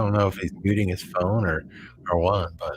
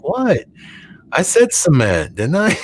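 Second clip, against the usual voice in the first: high, loud disbelief at being corrected; indignant surprise; 8 semitones higher, 6 dB louder than usual. Retold or imitated. Defending his pronunciation to a teasing relative, higher and louder. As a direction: protest like you were wronged. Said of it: "And I'm like".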